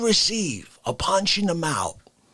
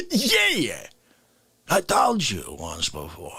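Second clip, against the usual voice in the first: smug concert fan; 7 semitones lower, slower than usual. Retold or imitated. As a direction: play the fan who has heard it all. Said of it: "not been like".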